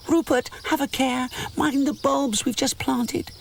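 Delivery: tiny sprites scolding the bear; imps crying out. A narrator's reading. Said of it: "cry the imps of spring".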